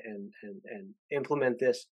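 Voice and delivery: stuck, repeating words; stammer, can't get it out; stuttering. Real, not acted. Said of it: "everybody can literally hear me stutter".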